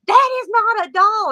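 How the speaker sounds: high, loud disbelieving protest; the manager refusing to believe, raising his voice high; loud insistent denial. Retold or imitated. Manager insists it's real.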